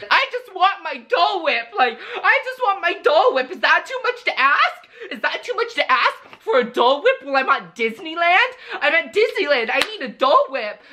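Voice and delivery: put-on whiny girlfriend. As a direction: whine it like a spoiled girlfriend. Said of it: "that's exactly how she was saying it".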